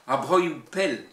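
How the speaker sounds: Breton verse with strong Cornouaille stresses; emphatic Cornouaille Breton recitation; Cornouaille Breton accent. Shown as a demonstration. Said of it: "l'accent cornouaillais".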